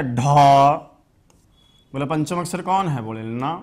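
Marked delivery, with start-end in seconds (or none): 0.0-0.7 ढा said loudly with a breathy release; aspirated dha. Shown as a demonstration. Teacher reading out ढा to the class. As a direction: push air out on ढा. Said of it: "इसके बाद वाला क्या".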